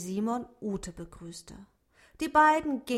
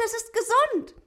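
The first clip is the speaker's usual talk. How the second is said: much higher, loud cheer falling on gesund; 14 semitones higher, 8 dB louder than usual. A narrator's reading. Ute cheers again that the rabbit is healthy, falling on "gesund". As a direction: shout for joy, landing "gesund" with a fall. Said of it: "Ute jubelte".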